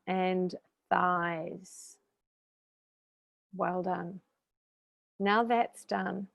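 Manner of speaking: slow, soothing meditation voice with long pauses; guided meditation. Real, not acted. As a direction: ease them gently through each breath. Leads a guided breathing meditation.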